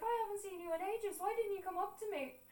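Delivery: very high mimicking voice; high-pitched mock questioning of an absent visitor; imitated aunt's complaint. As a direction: plead in a high put-on voice. Aunt asking why no visits.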